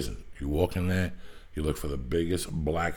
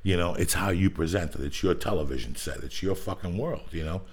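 normal talking voice

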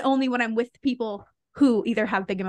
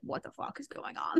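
breathy whispered aside, much softer; mock whisper; 4 semitones higher, 9 dB quieter than usual. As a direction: whisper it as if baffled at yourself. Self quote, confused at own crying.

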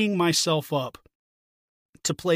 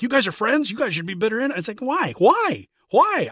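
incredulous; 7 semitones higher, 4 dB louder than usual. Retold or imitated. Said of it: "you people are like".

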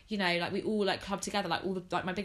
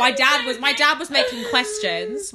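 louder, laughing through the words; 5 semitones higher, 12 dB louder than usual. Real, not acted. Telling her friend about dad's quizzes, laughing. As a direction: still giggling as she tells it.

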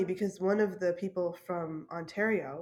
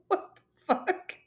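high, slow laughing what the fuck; incredulous laughing curse; laughing disbelief; 10 semitones higher, slower than usual. Real, not acted. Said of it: "And I'm laughing".

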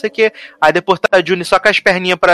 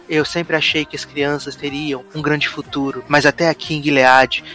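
low, hushed narrator's voice-over; mock-solemn TV drama voice-over; 5 semitones lower, 4 dB quieter than usual. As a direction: narrate it low and grave, as an inner monologue. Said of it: "[name] fazendo voice-over".

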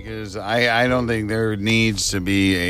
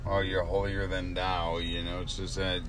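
too quiet; 9 dB quieter than usual. Real, not acted. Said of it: "You gotta speak up ... I can't hear you".

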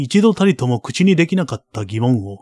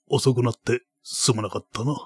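put-on creaky wise old man; 7 dB quieter than usual. Baron speaks to the boy.

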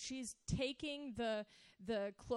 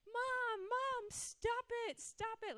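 imitating a girl's high, drawn-out whine; much higher, protesting like a cross child; angry whining protest; 12 semitones higher, slower than usual. Girl protesting mother's kisses, angry at first. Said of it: "she was angry at first".

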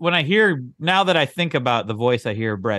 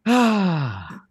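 long audible sigh; 4 semitones higher than usual. Real, not acted. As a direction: let out a long sigh before starting. Opens his timed minute with sigh.

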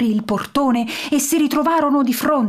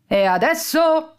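loud and clear; 7 semitones higher than usual. A narrator's reading. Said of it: "disse forte e chiaro il tenente Piccione".

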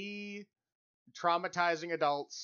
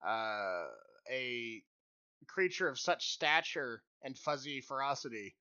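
ordinary delivery

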